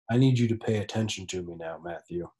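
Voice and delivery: low, husky sick-day murmur; hushed, sultry sick voice; sexy sick voice. Pretend. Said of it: "doing it in my sexy, sick voice".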